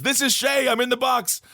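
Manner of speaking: high-pitched comic calling voice; mock wail of a man in a box; [name]. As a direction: call out from the coffin, stretching the final word. Dead man calling from casket.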